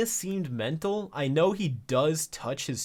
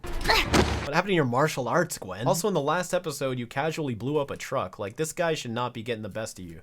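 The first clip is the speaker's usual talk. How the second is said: ordinary delivery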